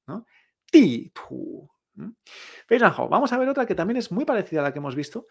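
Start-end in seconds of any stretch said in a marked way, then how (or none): 0.7-1.7 low, loud "Ti" dropping, "tu" lifting; falling then rising tones. Shown as a demonstration. Teacher recapping the intonation, "Ti" falling and "tu" rising. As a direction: punch "Ti" downward, then raise "tu". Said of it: "esta entonación".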